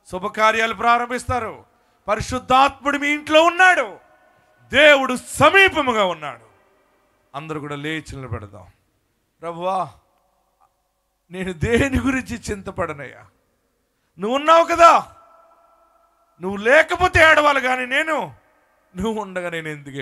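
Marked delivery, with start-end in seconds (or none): 2.1-6.5 a preacher's loud declaration; loud proclamation. Acted. Proclaims the verse loudly. 14.2-18.2 higher, louder pleading to God; praying aloud with fervour; fervent prayer. Acted.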